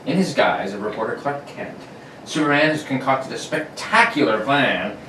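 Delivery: put-on old-time radio narration; radio announcer. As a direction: narrate like a 1940s serial.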